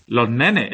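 Los nenes with the final s dropped, lo nene; aspirated s. Shown as a demonstration. Teacher showing Málaga s-aspiration to students. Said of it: "como una especie de H aspirada".

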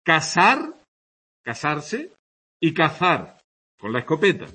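"Casar" with a clear S, "cazar" with a lisped Z; S versus Z distinction. Shown as a demonstration. Teacher demoing Castilian distinction, crisp S and Z. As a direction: contrast "casarse" and "cazar", S against th. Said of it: "Distinción entre la S y la Z".

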